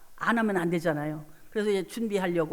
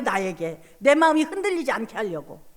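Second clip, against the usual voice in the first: usual speaking voice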